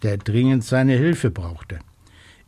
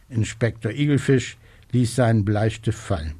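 usual speaking voice

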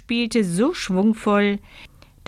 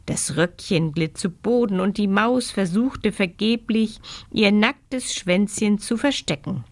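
usual speaking voice

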